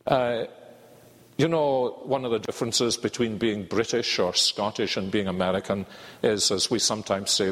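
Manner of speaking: ordinary delivery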